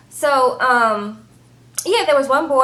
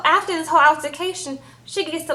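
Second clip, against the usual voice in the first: ordinary delivery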